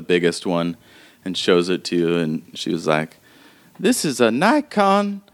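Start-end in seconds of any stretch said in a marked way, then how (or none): 3.8-5.2 put-on old woman npc.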